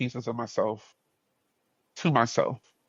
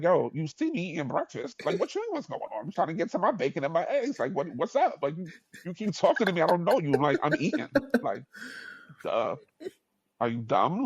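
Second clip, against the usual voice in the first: higher, exasperated street attitude; annoyed New Yorker; 5 semitones higher than usual. Retold or imitated. Narrator retelling how he snapped at a stranger over breakfast, exasperated and pitched up. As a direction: brush him off like he's wasting your time.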